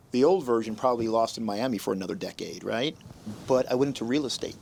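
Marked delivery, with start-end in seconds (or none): none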